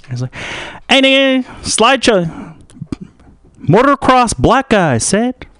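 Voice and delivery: high put-on street voice; excitable local. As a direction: jeer in a high, animated voice.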